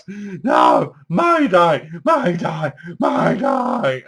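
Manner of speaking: hammed-up panicky shout of Mayday, badly acted; bad acting shout. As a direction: yell it like a terrible actress. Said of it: "[name] can't act for crap".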